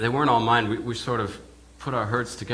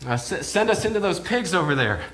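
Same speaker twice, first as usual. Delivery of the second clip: desperate pleading; 6 semitones higher, 4 dB louder than usual. Retold or imitated. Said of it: "begging and pleading".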